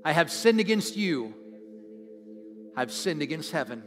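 slow, loud phrases with long pauses to repeat; slow congregational prayer. Real, not acted. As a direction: lead the room in prayer, slowly and strongly. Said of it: "I'm gonna say it slowly".

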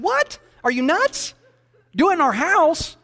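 a laughing shriek of disbelief; stunned outburst. Retold or imitated. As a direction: blurt it out as if she's lost her mind. Pastor reacting to house shower idea.

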